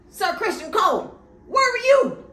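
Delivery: high, loud, raging demand; high-pitched loud outrage at the knight; furious shout. Retold or imitated. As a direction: yell it like a furious young king. Aegon voiced raging at Cole.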